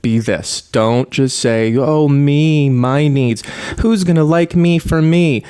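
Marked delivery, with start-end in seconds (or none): 1.8-5.4 loud, high, drawn-out mock wail; mock self-pitying whine. Telling viewers what not to say, in a mock whine. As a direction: ham up a needy, self-absorbed plea. Mocking a self-centered attitude.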